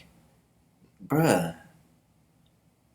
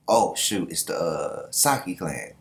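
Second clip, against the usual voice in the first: ordinary delivery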